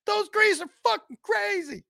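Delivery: high, laughing panicked dog. Retold or imitated. Voices his fleeing dog in a high laughing squeal. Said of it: "came running back like".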